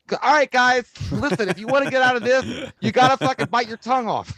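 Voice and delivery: high, loud chirpy fitness voice; peppy aerobics instructor voice; Richard Simmons impression. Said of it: "might as well got Richard Simmons".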